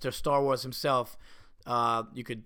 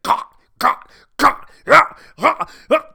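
high, loud hacking cough noises; hammed-up coughing fit like a choking dog; imitating dog's hacking cough; 12 semitones higher, 13 dB louder, slower than usual. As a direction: mimic the dog hacking something up. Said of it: "he was like".

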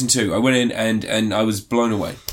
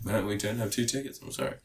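much softer, apologetic; quiet apology; 11 dB quieter than usual. Retold or imitated. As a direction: say it softly, sorry. Staff apology quoted.

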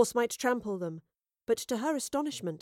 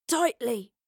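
strained word squeezed out of breath; winded wheeze; 3 semitones higher, 4 dB louder than usual. A narrator's reading. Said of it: "she wheezed".

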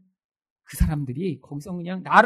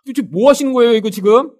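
louder, fed-up demand; worn-out exasperation; 2 semitones higher, 7 dB louder than usual. Acted. Weary believer demanding answers from God, exasperated. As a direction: act out running out of patience. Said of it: "너무 지금 지치고 힘들어서".

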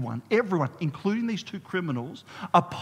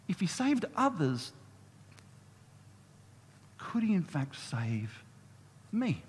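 hushed wonder; 7 dB quieter than usual. Retold or imitated. Criminal wonders quietly.

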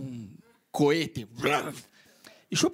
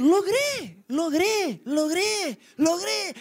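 higher, strained chant of triumph; mock triumph; 10 semitones higher, 3 dB louder, much slower than usual. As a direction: chant the win in a put-on voice. Triumphant inner voice. (voz aguda y forzada de triunfo)

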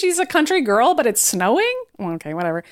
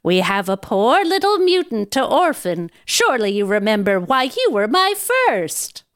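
lightly voiced prompting nanny; chiding Nanny. Nanny interrupts the boy's wish list, gently chiding. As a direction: remind the boy pointedly, as his nanny.

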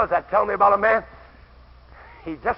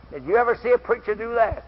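normal talking voice

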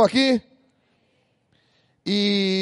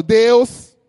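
loud call of God; 6 dB louder than usual. Shown as a demonstration. Pastor leading the congregation, loud call. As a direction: shout Deus out strong. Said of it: "fala bem forte".

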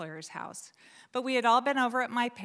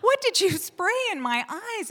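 alarmed pleading question; 9 semitones higher, 5 dB louder than usual. Retold or imitated. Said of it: "I said".

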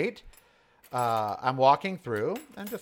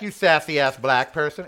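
louder, sharp comeback; loud retold comeback; 2 semitones higher, 8 dB louder than usual. Retelling the insult to the guard, loud. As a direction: toss the insult back. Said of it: "It's like".

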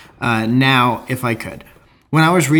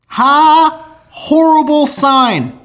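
mock horrified laugh; 12 semitones higher, 5 dB louder, much slower than usual. Mock outrage reply to friend.

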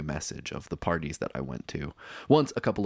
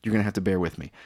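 normal talking voice

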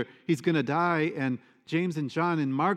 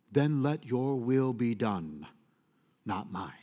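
submissive prayer. Retold or imitated. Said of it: "Father, this cup may pass me by".

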